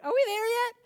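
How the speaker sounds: piping back-seat whine; high-pitched kid voice. Retold or imitated. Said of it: "rather high-pitched voice in the back seat".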